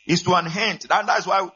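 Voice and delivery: fired-up sermon voice; full-force preaching. Acted. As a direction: preach it out, rising to the back row.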